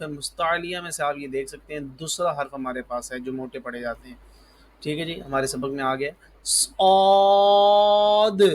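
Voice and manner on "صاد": صاد full-mouthed, long high vowel, released d at the end; heavy saad. Shown as a demonstration. Teacher models for pupils, heavy long صاد. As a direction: hold صاد loud and thick, sound the final d. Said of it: "د آخر میں اور منہ پھلا کے".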